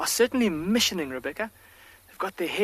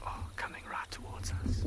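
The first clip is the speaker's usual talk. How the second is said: real excited whisper; hushed, whispered wildlife commentary; lowered voice; 9 dB quieter than usual. Real, not acted. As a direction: whisper it very softly to the viewers. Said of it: "lower my voice a little bit".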